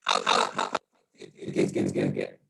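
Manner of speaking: muffled, distorted Zoom audio, low and dull; muffled mic. Real, not acted. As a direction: sound as if through a broken microphone. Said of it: "I'm coming in muffled".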